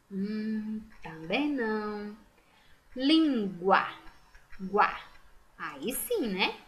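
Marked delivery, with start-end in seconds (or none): 2.9-5.0 "Língua" split, then "Guá" alone with a clear gw; "Língua", "Guá", U clearly voiced; U sounded out. Shown as a demonstration. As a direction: isolate the gwa of "Língua".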